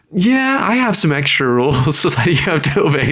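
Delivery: a sheepish genie. Retold or imitated. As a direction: admit it with a chuckle. Genie states extra rules.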